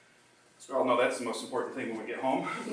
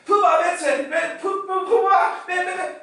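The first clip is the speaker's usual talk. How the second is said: much higher and louder, gleeful chanting; excited child; 9 dB louder than usual. Retold or imitated. Excited grandson running back calling grandpa's nicknames, high and loud. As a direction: squeal the nicknames like a thrilled kid. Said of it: "he was so excited".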